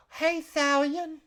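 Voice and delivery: high, loud, drawn-out call; excited greeting. Acted. Farm girl spotting Thalion in the tavern, excited high call. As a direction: call out happily in a high girlish voice.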